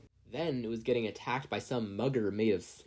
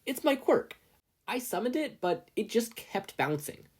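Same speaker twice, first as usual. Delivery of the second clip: put-on high, hurried boy voice; a flustered teen justifying his runaway quirk, high and hurried; rushed explanation; 12 semitones higher than usual. A narrator's reading. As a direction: hurry to explain yourself. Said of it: "Izuku rushed to clarify".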